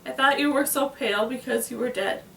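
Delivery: ordinary delivery, nothing marked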